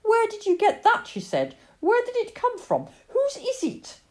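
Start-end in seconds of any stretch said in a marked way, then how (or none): 0.0-1.1 high, loud startled mother; startled demand. Acted. Mother spots the stray in Lily's arms, high startled questioning. 1.8-4.0 high-pitched motherly alarm; startled questioning. Acted. Mother questions Lily about the stray cat, high and startled.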